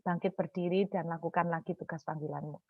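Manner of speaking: low, very soft divine voice; God. Retold or imitated. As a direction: speak quietly and solemnly, as a calling. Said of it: "Tuhan itu berbicara".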